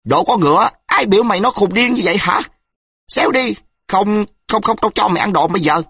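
high, loud, fast furious shriek; man shrieking insults at the intruder; shrieking rage. A narrator's reading. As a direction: spit out the insults in a fury. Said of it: "rít lên".

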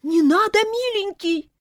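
high, loud, panicky cry; alarmed aunts. A narrator's reading. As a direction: beg the boy to stop in alarm. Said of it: "заволновались тётки".

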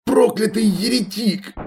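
high, slow venomous muttering; muttering curses. A narrator's reading. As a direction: spit out the curses hatefully. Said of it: "бормотала она".

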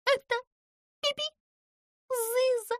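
high shrieking squeal of a name. A narrator's reading. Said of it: "взвизгнула она".

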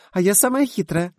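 put-on high, loud cheeky child voice; laughing twin. A narrator's reading. Twin girl laughingly boasting to the guest. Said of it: "засмеялась близняшка".

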